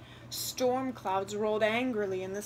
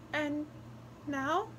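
almost sad; 6 semitones higher than usual. A narrator's reading. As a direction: ask as if already sorry. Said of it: "the wren asked, almost sadly".

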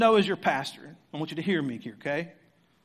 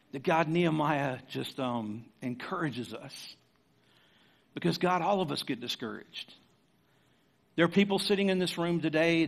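prayer; 4 semitones lower than usual. Real, not acted. Closing prayer.

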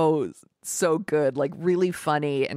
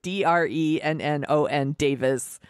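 ordinary talk

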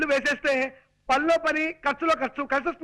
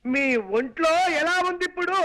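over-projected, insistent question; raised voice. Acted. Man repeats health question to old aunt, raised voice. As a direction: speak up so she can hear. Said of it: "అంత గట్టిగా అరవకు నాయనా".

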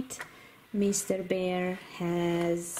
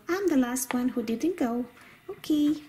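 ordinary delivery

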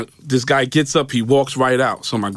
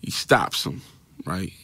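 ordinary delivery

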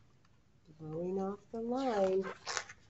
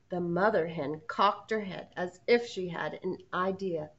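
ordinary delivery

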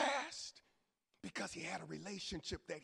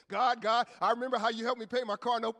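eager thanks; 2 semitones higher, 9 dB louder than usual. Acted. Thankful prayer to God, voiced.

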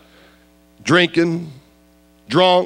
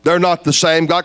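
normal talking voice

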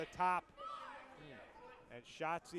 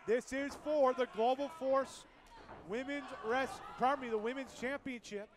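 ordinary talk, nothing marked

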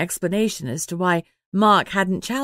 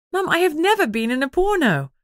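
exasperated protest; 9 semitones higher, 4 dB louder than usual. Acted. Lucy protests to her mum.

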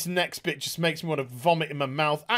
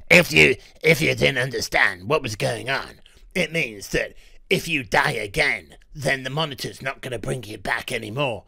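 low, forced superhero voice; mock a tough vigilante, pitched down; Batman; 5 semitones lower than usual. Reviewer mocking the actress explaining death, low hero impression. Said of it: "I've got to do an impression of Batman".

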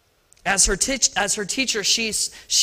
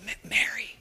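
breathy whispered Mary; gentle whisper of Mary; soft whispered name; 8 dB quieter than usual. Shown as a demonstration. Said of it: "How did he say Mary?".

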